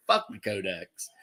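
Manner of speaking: snickering aside. Pretend. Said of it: "they're like".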